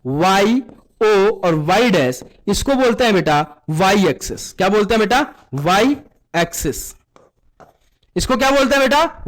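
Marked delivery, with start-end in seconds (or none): 5.5-6.9 slow modelled answer. Shown as a demonstration. Teacher models answer for class.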